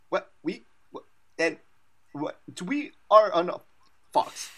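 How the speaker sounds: high, garbled broken-audio voice; mock garbled broadcast glitch; Fox transition impression. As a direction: mimic a cutting-out broadcast feed. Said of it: "this is my impression of, uh, the transition to Fox".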